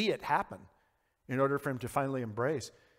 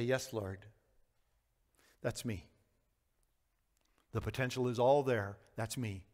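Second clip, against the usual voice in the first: low, soft prayer of surrender; hushed confession to God; humble prayer; 5 semitones lower, 7 dB quieter than usual. Acted. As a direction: pray quietly, lower and softer, with pauses.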